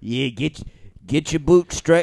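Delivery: Cockney boss hurrying his mate; broad Cockney accent; hammed-up London Cockney. Shown as a demonstration. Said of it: "London Cockney".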